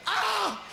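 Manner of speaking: a sudden high yelp of alarm; high gazelle cry. Acted. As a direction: squeal it like a frightened animal. Gazelle mother cries out.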